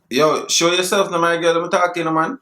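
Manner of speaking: mock Jamaican accent, chatting up a girl; put-on accent. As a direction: fake Jamaican patois voice. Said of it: "You just accent".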